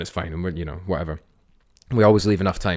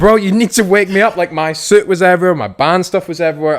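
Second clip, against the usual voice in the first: high, loud panicked yell; panicked shout; 11 semitones higher, 9 dB louder than usual. Retold or imitated. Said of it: "I was like".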